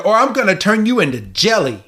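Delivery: high, fast villainous threat; hammed-up cartoon scolding, rushed out; mock scolding. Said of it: "just starts scolding fucking".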